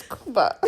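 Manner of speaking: Cuba pronounced "KOO-ba"; Slovak-style "KOO-ba" for Cuba, no y glide. Shown as a demonstration. Speaker explaining the slip to a friend, Cuba as "KOO-ba". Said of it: "that's how they say it in Slovak".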